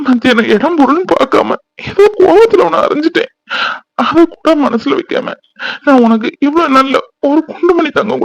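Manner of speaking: high tearful apology, close to sobbing; crying. Acted. Husband weeping as he apologises to his wife. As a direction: beg forgiveness through tears. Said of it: "சின்ன பிள்ளைகிட்ட அழுதுட்டு".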